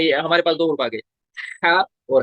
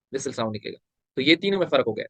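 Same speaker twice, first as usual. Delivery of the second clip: ordinary talk, nothing marked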